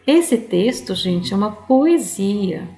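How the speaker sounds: ordinary talk, nothing marked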